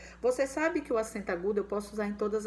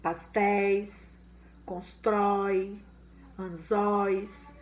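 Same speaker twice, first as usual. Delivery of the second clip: slow example words; much slower than usual. Shown as a demonstration. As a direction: stretch each word out clearly. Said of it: "Aí eu tenho anéis".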